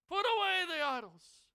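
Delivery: loud, high heartfelt cry. Retold or imitated. Joshua cries out to his people, pleading loudly. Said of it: "he's crying out from his heart".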